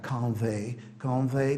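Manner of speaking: convey ending in "ay". Shown as a demonstration. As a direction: say convey as con-VAY. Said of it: "a pronúncia é ei".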